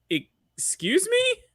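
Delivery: high, slow shocked "Excuse me"; incredulous. Retold or imitated. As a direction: react in disbelief, drawn out. Retold reaction.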